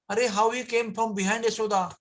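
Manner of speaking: frustrated surprise. Acted. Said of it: "so frustrated and so surprised".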